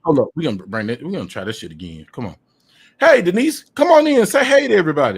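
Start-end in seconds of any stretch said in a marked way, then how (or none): 3.0-5.2 high, fast, loud call to his guest to say hey; loud excited call. Real, not acted. Host loudly calling Denise in for her redo greeting. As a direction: call her in like a hype man.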